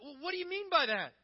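high, loud, defensive challenge; touchy, changed tone. Acted. Touchy person challenges the minister who found a sore spot, defensive. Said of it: "You know, the tone changes".

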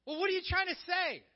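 loud, high-pitched indignation; touchy, changed tone. Acted. Upset person confronts the minister over a sore subject, indignant. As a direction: demand an answer, voice jumping up. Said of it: "You know, the tone changes".

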